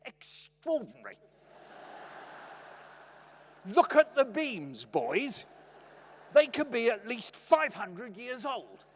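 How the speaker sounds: loud, slow, hammed-up fatherly wonder; drawn-out parody of an awestruck dad; mocking dad voice. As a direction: mimic a dad marvelling grandly to his boys. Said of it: "puts on his dad voice".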